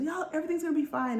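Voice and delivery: high, quick breezy reassurance; lightly played happy optimist; joyous Lumiere. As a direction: toss it off cheerfully, fast and high. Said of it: "so happy and so joyous and full of life".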